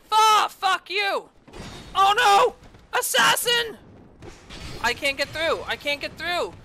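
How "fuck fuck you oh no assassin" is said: high, loud startled yells; alarmed shouting. Real, not acted. Attacked by assassin.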